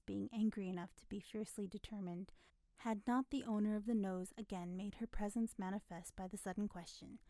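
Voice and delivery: ordinary delivery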